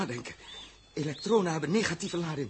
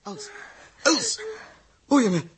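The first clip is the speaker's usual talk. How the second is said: loud, higher desperate call to her; urgent call; 13 semitones higher, 3 dB louder than usual. Acted. Captain calling out to dying Els, loud and desperate. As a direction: cry out to reach her.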